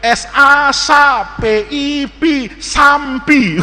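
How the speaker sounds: slow spelling ending in sampi with an extra M; misreading with added M. Acted. Classmate reads for teacher, slow spelling with M in sampi. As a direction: spell it slowly, then slip M into sampi. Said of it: "Teman saya nggak gitu".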